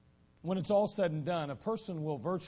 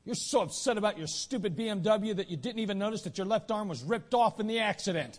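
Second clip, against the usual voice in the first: scolding officer; 7 semitones higher, 5 dB louder than usual. Acted. Officer continues scolding lawyer.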